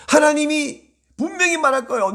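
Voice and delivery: ordinary delivery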